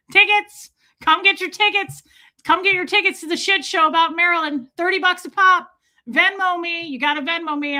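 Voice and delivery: high, loud carnival-style hawking; mock ticket scalper. Streamer sells a gossip show for thirty bucks, mock scalper's call. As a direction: spoof a scalper shouting for customers. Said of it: "he becomes a scalper".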